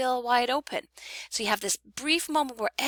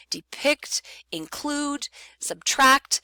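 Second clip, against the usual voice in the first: DEPICT, INCLUDE, SUBTRACT spaced out, each raised on its second syllable; stressed second syllables; 6 semitones higher, much slower than usual. Shown as a demonstration. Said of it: "are the stressed syllable of the word".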